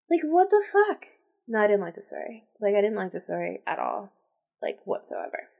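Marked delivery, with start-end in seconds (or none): 0.1-1.1 high-pitched, incredulous outburst; exasperated. Real, not acted. Reader fed up with the queen, exasperated. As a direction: let disbelief push the pitch up. Reader rants about the story.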